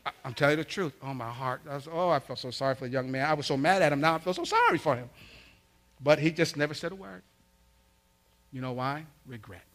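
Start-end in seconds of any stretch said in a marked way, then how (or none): none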